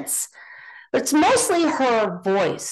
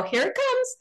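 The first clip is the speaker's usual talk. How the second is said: high-pitched retold aside; retold out-loud aside; 14 semitones higher than usual. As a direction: say it high, as if announcing trouble coming. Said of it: "and out loud, I go".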